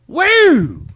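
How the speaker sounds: high, loud whooping yell; loud cheer. Acted. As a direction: whoop it like a stadium fan. Patriotic cheer.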